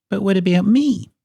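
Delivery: high, quick little bird voice; plaintive high-pitched question; Kiwi. A narrator's reading. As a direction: pipe up high, wanting to be included. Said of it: "asked Kiwi".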